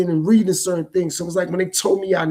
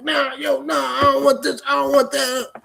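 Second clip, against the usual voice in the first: higher-pitched defiant imitation of a patient refusing; mock the aggravated no, pitched up; rebellious refusal; 6 semitones higher than usual. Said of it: "The way you sounding".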